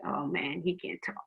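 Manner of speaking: low, soft onlooker's aside; mock disappointment. Onlookers judging someone who curses, quietly disappointed. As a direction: drop it low like a let-down bystander.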